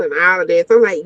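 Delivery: usual speaking voice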